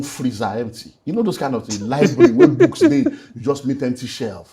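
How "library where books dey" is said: laughing in disbelief. Real, not acted. Empty shelves after wife left.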